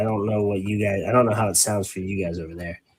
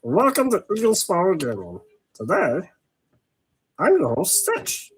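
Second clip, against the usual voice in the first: high cartoon alien playing show host; Stitch voice; 7 semitones higher than usual. Streamer imagining a Warhammer channel hosted in character, Stitch voice. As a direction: introduce the show as a little alien. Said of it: "always doing the Stitch voice".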